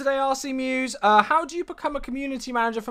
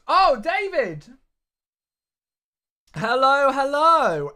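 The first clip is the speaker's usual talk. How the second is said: delighted greeting; 4 semitones higher, 5 dB louder, much slower than usual. Real, not acted. Greeting a loyal subscriber.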